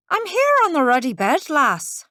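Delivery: a cross little Irishman calling up; Irish accent. Acted. Leprechaun character voice.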